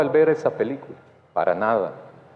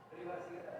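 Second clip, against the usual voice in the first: susurro del público; 27 dB quieter than usual. Real, not acted. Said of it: "Privacidad, sí, gracias".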